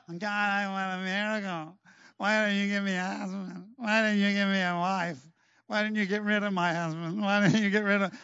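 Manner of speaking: mimicked whiny pleading; moaning and whining.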